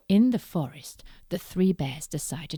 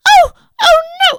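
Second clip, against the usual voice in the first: very high loud scared cry; very high loud frightened cry; startled Goldilocks; 21 dB louder, faster than usual. Acted. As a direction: gasp out in fright. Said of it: "And Goldilocks went".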